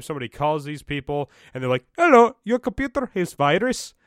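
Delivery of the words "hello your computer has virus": put-on high, loud scam caller with a botched Indian accent; muddled Indian accent. Scammer calling about a computer virus, wobbly Indian-Swedish accent. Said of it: "went from Indian to f-, at first to, like, some kind of incest Swedish".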